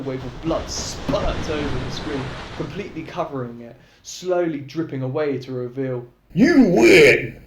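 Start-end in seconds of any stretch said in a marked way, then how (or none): 6.3-7.4 loud victory announcer; dramatic announcer. A narrator's reading. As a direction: boom out the win. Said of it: "a dramatic voice calls out".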